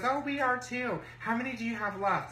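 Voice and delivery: high-pitched imitation of her chatter; mimicked rival woman. Rival comparing progress on the selfie hunt, high mimicking voice.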